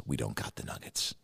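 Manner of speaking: hushed unvoiced whisper. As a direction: breathe the bad news out. Clerk whispering to her face.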